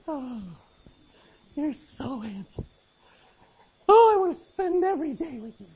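put-on high, breathy-free swooning girl voice, building to a squeal; gushing girlfriend. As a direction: play the smitten girlfriend, rising into delight. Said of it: "She goes".

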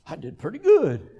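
pleased with yourself, big swoop on "good"; mock self-congratulation. Someone admiring their own public prayer, mock smug. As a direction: imitate someone impressed by their own performance. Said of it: "Because you'll go".

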